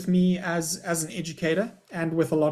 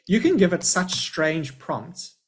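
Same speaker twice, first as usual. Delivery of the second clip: ordinary delivery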